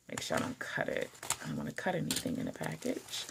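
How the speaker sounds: hushed and quick while cutting tape; careful whisper. Real, not acted. Vlogger concentrating on cutting the package, hushed murmur. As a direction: murmur to yourself so you don't slip with the blade.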